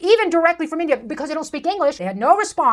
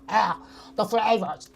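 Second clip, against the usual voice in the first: mock food reviewer; 5 semitones lower, 7 dB quieter than usual.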